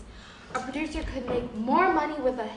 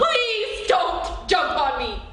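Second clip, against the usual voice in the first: drawn-out high begging, louder; slow hysterical plea; 5 semitones higher, 7 dB louder, much slower than usual. Acted. Accountant begs boss not to crush him, slow hysterical pleading. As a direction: stretch each word out in panic. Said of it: "I'm hysterical!".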